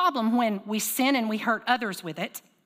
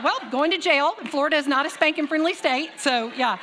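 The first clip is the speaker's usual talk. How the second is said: laughing quip; 5 semitones higher, 4 dB louder than usual. Retold or imitated. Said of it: "I was like".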